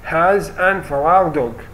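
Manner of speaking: reading the exam sentence very slowly; dictating slowly to students; slow example read. Shown as a demonstration. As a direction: spell it out word by word for the class. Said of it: "يقول my niece".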